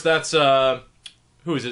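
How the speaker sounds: ordinary talk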